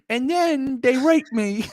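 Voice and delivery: high, slow mock-British whine; pitiful British child. The boy continues his tale of woe, mock pitiful British child. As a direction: drag it out as a hammed-up English orphan.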